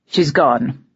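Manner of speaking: gruff, blunt reply. Acted. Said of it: "said a gruff voice".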